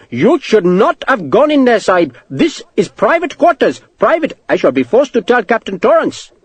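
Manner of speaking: put-on very high, accented, scolding clerk; indignant clerk. Clerk scolding Sharp for entering private quarters, indignant. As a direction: protest high and outraged. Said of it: "shook his head fiercely".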